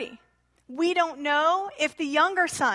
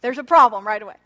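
ordinary talk, nothing marked

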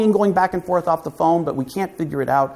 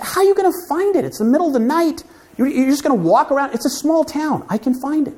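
higher, disbelieving voice; incredulous friends; 11 semitones higher than usual. Retold or imitated. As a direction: scoff at him in disbelief. Friends mocking his plan.

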